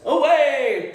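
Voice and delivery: loud, commanding call to the horse; knight's shout. Shown as a demonstration. The good knight calls to his horse, a loud shout. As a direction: bellow it like a knight spurring on. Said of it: "and he says".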